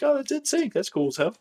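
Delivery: high, thrilled exclamation of surprise; a starstruck yelp at a famous band; excited spotting. Retold or imitated. To a friend while passing the band, high thrilled exclamation. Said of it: "and I was like".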